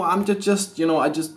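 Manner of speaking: ordinary talk, nothing marked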